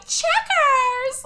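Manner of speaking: high, loud imitation of a silly shriek; mock dog call. Imitating Dolly calling Checkers, loud high mock shriek. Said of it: "her being like".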